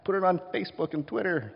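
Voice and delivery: high, excited gossiping crowd voice; excited disciples. Retold or imitated. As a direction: squeal it like scandalized gossips. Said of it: "there's a collective gasp".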